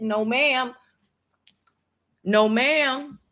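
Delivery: defiant no ma'am. Real, not acted. Vlogger defying developers, sassy refusal. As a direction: shut it down with sass.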